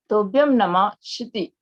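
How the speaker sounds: ordinary talk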